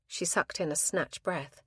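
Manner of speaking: plain reading voice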